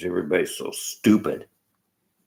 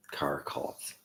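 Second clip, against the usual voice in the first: scornful whisper; 3 semitones lower, 10 dB quieter than usual. Real, not acted. Mocking dismissal of car cults.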